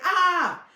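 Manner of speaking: imitated high, loud "ah" on one note; monotone yell. Showing how the brother said it, imitated monotone yell. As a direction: hold a loud, high "ah" without real screaming. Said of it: "just like that".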